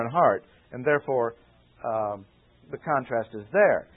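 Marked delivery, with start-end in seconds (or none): none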